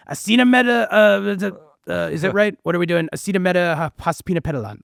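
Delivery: bad Donald Trump impersonation. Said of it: "I'll do my bad Trump impersonation".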